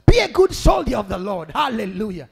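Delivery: loud, punched exhortations; rousing preacher's charge; forceful preaching. Acted. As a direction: urge the congregation on, phrase by phrase.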